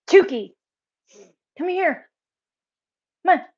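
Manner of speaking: loud, firm call to the dog. Real, not acted. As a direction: shout for him to come now. Calling dog loudly.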